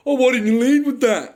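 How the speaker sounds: high, loud mocking cop voice; mocking the cops who pulled guns on him; mock dumb cop. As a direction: sneer it out as a clueless cop.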